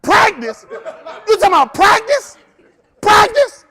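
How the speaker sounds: loud, high mocking laugh-shout; incredulous shout. Coach mocking player whining about practice, shouting. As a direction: ridicule the word in disbelief.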